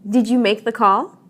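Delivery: yes-or-no question, voice climbing on "call"; rising intonation. Shown as a demonstration. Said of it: "your voice rises when you ask".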